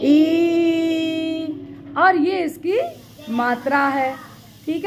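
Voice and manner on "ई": a long, drawn-out ई sung for the class. Shown as a demonstration. Teacher modelling the long vowel ई for children. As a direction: stretch the vowel ई clearly. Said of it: "ये क्या है?".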